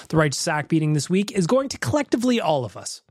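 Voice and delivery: ordinary talk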